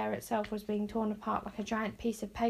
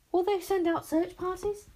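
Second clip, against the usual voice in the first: high, loud and anxious; frightened squeak of a question; panicked question; 9 semitones higher, 4 dB louder than usual. A narrator's reading. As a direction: voice jumps up in panic. Bodkin panicking.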